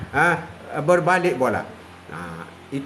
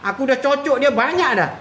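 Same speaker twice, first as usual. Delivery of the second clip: syaitan complaining, pitched higher; complaining devil; 7 semitones higher than usual. Acted. Preacher voices the devil grumbling at a lost soul. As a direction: whine like a tired devil. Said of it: "syaitan kata".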